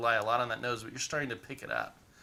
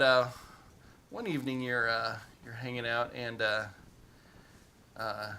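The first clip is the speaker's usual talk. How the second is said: normal talking voice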